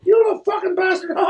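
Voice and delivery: very high, fast cartoon mouse; cussing squeaky mouse voice; Mickey Mouse. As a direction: squeal it fast in falsetto, ending in a giggle. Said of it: "Mickey shows up".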